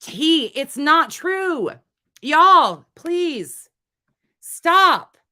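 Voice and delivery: loud, high, exasperated plea to chat; begging them to stop, fed up; heated plea. Real, not acted. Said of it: "I'm really heated right now".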